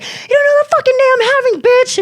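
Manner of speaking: fast, high-pitched angry outburst; so mad. Retold or imitated. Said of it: "I was, like, so mad".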